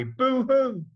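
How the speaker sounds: a cartoon wail of fake crying; mock boo-hoo. A narrator's reading. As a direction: pretend sobbing. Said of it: "all the saints will all cry".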